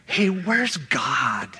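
slow, hushed whisper of doubt; Paul whispering his doubt to himself, hushed; whispered lament. Retold or imitated. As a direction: breathe it out like a secret complaint to heaven. Paul's imagined private complaint.

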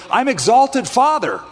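high, proud little announcement; proud self-introduction. Retold or imitated. Abram telling the travelers his name, high proud voice. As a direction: say the name with mock pride. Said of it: "And he'd say".

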